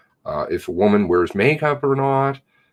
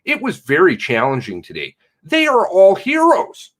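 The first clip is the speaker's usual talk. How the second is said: mocking read of the statement; 7 semitones higher than usual.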